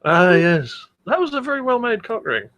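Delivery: usual speaking voice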